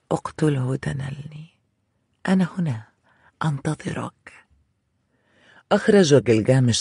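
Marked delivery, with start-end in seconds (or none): 0.1-4.3 whispered, teasing seduction; femme fatale. Acted. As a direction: breathe it into his ear, coaxing. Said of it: "همست عشتار بأذنه بدلال".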